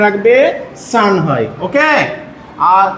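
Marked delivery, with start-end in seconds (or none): none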